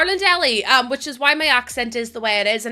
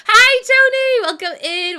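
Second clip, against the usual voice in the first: high, loud hello; excited greeting; 10 semitones higher, 6 dB louder than usual. Real, not acted. Streamer greeting a viewer in chat, excited. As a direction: call out a big hi.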